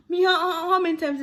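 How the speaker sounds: loud, high how-many-times exclaim; loud high mock outburst; exasperated.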